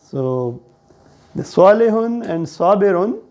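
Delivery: normal talking voice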